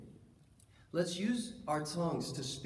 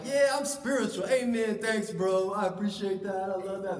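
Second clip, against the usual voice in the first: mock eager piety; 2 semitones higher, 6 dB louder than usual. Young self's eager reply.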